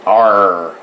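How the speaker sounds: "Our" with a hard, held R; accented consonant. Shown as a demonstration. Showing how Americans accent consonants, "Our" with heavy R. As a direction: lean on the R in "Our", pirate-like. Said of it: "we really accent our consonants".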